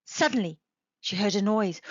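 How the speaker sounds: ordinary delivery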